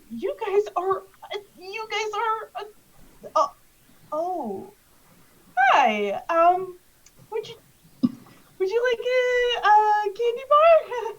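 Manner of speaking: high, halting, stumbling offer; nervous. Acted. Candy man kneels to offer kids a candy bar, nervous. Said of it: "kind of nervous".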